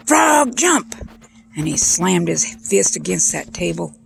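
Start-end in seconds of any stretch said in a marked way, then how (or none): 0.1-0.9 high-pitched holler at a frog; mock scientist's high yell; shouted command. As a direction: shout it like an urgent order.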